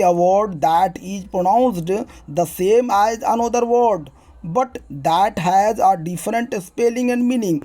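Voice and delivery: very slow, word by word, like dictating to a class; slow dictation. Shown as a demonstration. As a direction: read the definition slowly for students to copy. Teacher reads English definition aloud. (धीरे-धीरे बोलकर लिखवाना)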